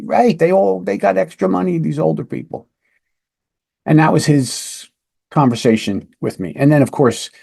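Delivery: ordinary talk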